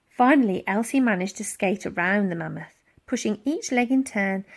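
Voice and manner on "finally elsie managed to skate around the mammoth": ordinary delivery, nothing marked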